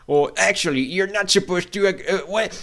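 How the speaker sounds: high-pitched pedant parody; mocking imitation. Imitating the philosophy server that banned him, mocking. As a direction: mimic a know-it-all, rising high and faltering.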